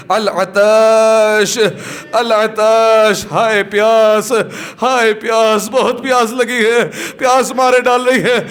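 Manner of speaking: long drawn-out plaintive wail; wailing thirst cry. Acted. Voice from the tent begging for water, wailing. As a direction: cry out in thirst, stretching the words.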